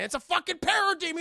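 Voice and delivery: high, loud shocked cry; hyped-up storyteller cry; excited reveal. Retold or imitated. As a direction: blurt the monster reveal. Horror reveal in retelling.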